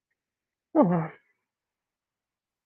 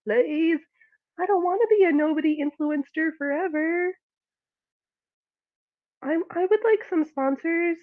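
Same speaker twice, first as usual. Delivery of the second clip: mock YouTuber plea; 5 semitones higher, 3 dB louder than usual.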